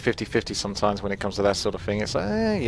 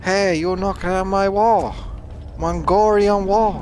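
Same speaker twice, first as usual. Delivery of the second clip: slow, higher mock Mongolian accent; 9 semitones higher, 4 dB louder, slower than usual. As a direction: ham it up in a put-on Mongolian accent. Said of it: "convince you all that I was actually Mongolian".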